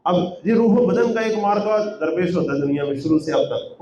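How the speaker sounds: ordinary talk, nothing marked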